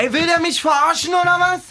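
high, indignant and disbelieving; indignant outburst. Real, not acted. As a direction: explode at the commentator. Rant at the commentator.

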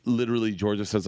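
ordinary delivery

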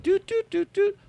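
high sung doot doot of a ringing line; phone ring noise. Retold or imitated. Comic imitating a ringing phone line.

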